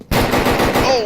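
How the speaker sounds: sudden high gasp of surprise; a sharp, startled yelp; surprised shout. Real, not acted. Pistol kill reaction shout.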